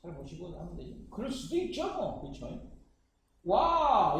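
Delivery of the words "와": loud, playful wow; hammed-up exclamation of awe; mock amazement. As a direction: burst out in put-on amazement. Mock amazement at next problem.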